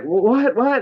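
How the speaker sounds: high, stammered imitation of surprise; mock startled. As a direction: act caught out, pitch jumping up. Acted startled reaction of the kids.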